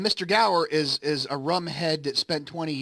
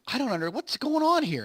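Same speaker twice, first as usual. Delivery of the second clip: high, startled disbelief; lightly acted bewilderment; confused George; 10 semitones higher than usual. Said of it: "And he's like".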